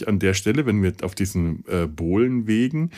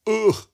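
disgusted ugh; 11 semitones higher than usual. Real, not acted. Disgust at the banking district.

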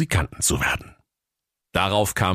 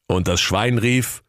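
usual speaking voice

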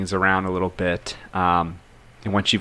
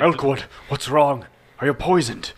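higher-pitched, worried questioning; alarmed concern; 7 semitones higher than usual. Acted. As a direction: call out to an ailing friend in alarm. Character on alert finds friend sick.

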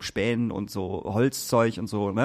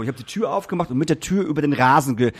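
ordinary delivery

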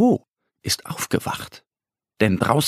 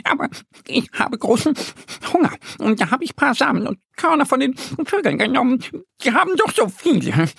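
much higher, fast squirrel voice; hurried squirrel excuse; 12 semitones higher, faster than usual. A narrator's reading. Squirrel justifies stealing the seeds, fast high squirrel voice. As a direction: rush out the excuse. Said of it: "erklärt das Eichhörnchen".

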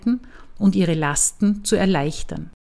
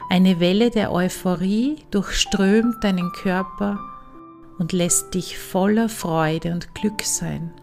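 calm, unhurried meditation voice with long pauses; guided meditation. Acted. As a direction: ease the listener into relaxation. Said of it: "Weiter geht es nun mit einer Meditation".

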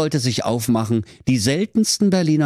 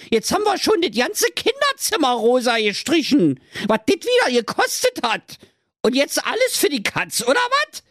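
flustered Trulla. Acted. Trulla finding the deal on her hand, high squeaky panic. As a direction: rant and squeal like a panicking cartoon mum.

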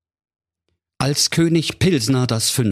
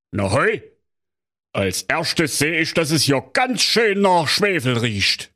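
Kommissar Bärbel Ehrlicher; 9 semitones higher than usual. Acted. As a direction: drag it out like a smug small-town cop. Commissioner reports.

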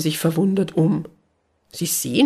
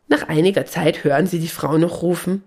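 ordinary delivery, nothing marked

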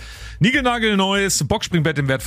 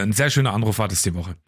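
ordinary delivery

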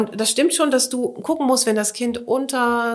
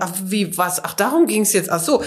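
put-on confused toddler; 4 semitones higher than usual. Voicing a surprised toddler.

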